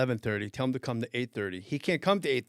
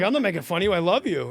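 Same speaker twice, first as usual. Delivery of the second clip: higher, louder pleading denial; retold earnest protest; 5 semitones higher, 5 dB louder, faster than usual. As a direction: re-enact reassuring the offended star. Retold protest to offended actor.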